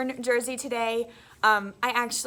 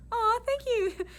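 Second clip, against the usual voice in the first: touched aw; 11 semitones higher, slower than usual. Retold or imitated. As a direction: melt a little at the kindness. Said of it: "I was like".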